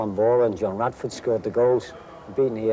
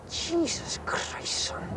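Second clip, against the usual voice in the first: astonished whisper; 7 dB quieter, much slower than usual. Retold or imitated. Manager reacting to player's question.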